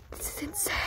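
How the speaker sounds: hushed whisper under the bed. Real, not acted. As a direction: whisper so nobody hears. Hiding under the bunk bed.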